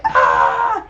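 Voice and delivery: mock scream, drawn out; imitated villain scream. Imitating a movie character's scream, mock scream. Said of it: "I like the scream that he does".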